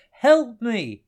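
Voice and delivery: high, loud plea for help; loud, pleading shout; desperate cry. A narrator's reading. Blinded man cries for help.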